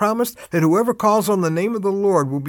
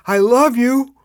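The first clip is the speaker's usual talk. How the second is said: higher and louder, love rising; mock calling out; 6 semitones higher, 5 dB louder, slower than usual. Retelling a voice in the tornado, calling out higher and louder. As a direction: call it out as if over the storm. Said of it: "another voice going".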